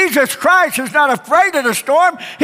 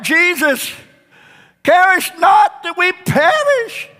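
high, slow pleading cry of frightened disciples; desperate cry; 7 semitones higher than usual. Acted. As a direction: beg for help as the boat sinks. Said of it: "And they cried".

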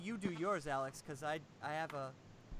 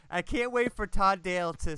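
ordinary talk

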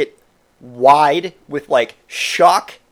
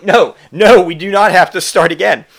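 loud, raised-pitch protest; exasperated refusal; 4 semitones higher, 7 dB louder than usual. Retold or imitated. Refusing to let drunk Tim restart counting stairs, exasperated. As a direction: shut the idea down loudly.

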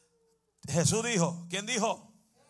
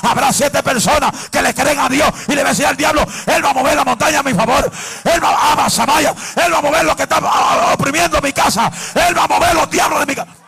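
loud preaching at full force, pitch raised; fiery preaching; 4 semitones higher, 8 dB louder than usual. Acted. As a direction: preach it, shouting to the church.

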